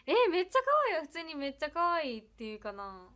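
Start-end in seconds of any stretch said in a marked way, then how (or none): 0.1-1.0 lively Iyo dialect, higher and louder. Shown as a demonstration. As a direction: show how it's said in Iyo dialect, with punch. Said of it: "雄弁やとなんて". (伊予弁で元気よく)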